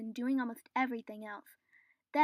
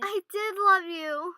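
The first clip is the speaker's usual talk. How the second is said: pleading cry; 6 semitones higher, 11 dB louder, slower than usual. Acted. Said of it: "Elizabeth cried".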